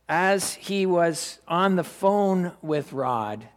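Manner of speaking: ordinary talk, nothing marked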